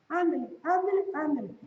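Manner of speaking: high, nagging mom's ándele; scolding mother. Retold or imitated. Preacher mimics a mother halfheartedly scolding her son, high-pitched. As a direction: nag the kid like a fed-up mother. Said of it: "y la mamá le hace".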